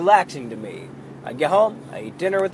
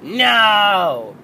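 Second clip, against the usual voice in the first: put-on stubborn child refusing; 6 semitones higher, 8 dB louder than usual. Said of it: "she'll be like".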